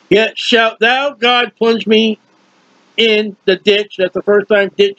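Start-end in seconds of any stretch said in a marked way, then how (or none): 0.1-4.0 slow scripture reading. Acted.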